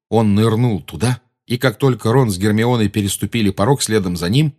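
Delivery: ordinary delivery, nothing marked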